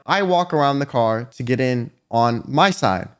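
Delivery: usual speaking voice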